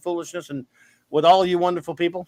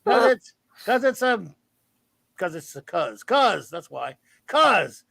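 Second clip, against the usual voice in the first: playful, lightly put-on voice; mild goofy voice; silly put-on voice; 4 semitones higher than usual. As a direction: keep the goofy raised voice going. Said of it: "I don't know why I'm talking like this".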